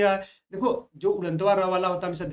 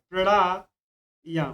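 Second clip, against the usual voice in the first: slow spelled-out demo; much slower than usual. Shown as a demonstration. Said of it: "प्राणायाम दे देगा".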